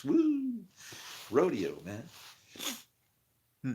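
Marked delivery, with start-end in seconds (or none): 0.0-0.6 high whoop; excited whoop. Real, not acted. Speaker cheering his own sermon with a high whoop. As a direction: whoop high after the big line.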